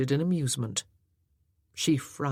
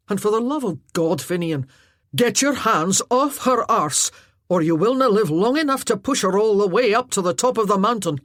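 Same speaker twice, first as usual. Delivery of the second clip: angry warning; 5 semitones higher, 8 dB louder than usual. Acted. Callum scolds Finian.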